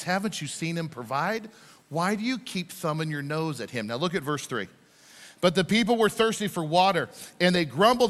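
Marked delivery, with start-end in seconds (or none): 0.0-3.9 softened, restrained frustration; frustrated Moses. Acted. Moses asking why they doubt God, quietly frustrated. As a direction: reprove them in a lowered, exasperated voice. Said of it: "hear the frustration in his voice".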